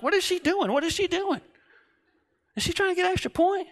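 high, disbelieving inner voice; an incredulous squeak, like you cannot believe her; baffled. Retold or imitated. Baffled thought about classmate.

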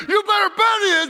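high, loud triumphant yell; screaming back. Retold or imitated. Thief yelling to the centurion below, loud shout. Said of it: "I screamed back at him".

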